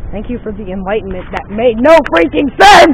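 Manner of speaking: sarcastic outburst. Real, not acted. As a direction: snap sarcastically then yell. Sarcastic outburst after bad advice.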